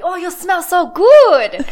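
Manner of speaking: slow, amazed compliment; drawn out, impressed driver. Retold or imitated. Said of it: "the person driving was like".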